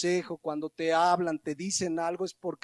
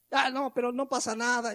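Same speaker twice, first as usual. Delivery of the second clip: dismissive; 6 semitones higher than usual. Retold or imitated. Parent brushes off the attendant.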